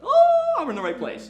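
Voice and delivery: the heavens just opened for you; sudden delight. Retold or imitated. Recalling the law school epiphany, a bright excited oh.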